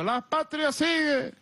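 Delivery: high, slow slogan answer; called-out reply. Retold or imitated. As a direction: re-enact calling back in the dream. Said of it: "Y yo le contesto".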